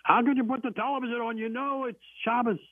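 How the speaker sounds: shrill-free but high, scold the husband like an angry grandmother; grandmother yelling. Retold or imitated. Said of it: "She started yelling at him".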